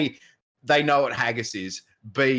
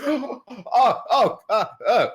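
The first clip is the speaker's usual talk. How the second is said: higher, louder hammed-up oh oh oh; mock startled gasp; 14 semitones higher, 5 dB louder, much slower than usual. Streamer acting out Mara dropping the bow, mock alarm. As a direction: gasp like she just dropped it. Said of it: "She goes".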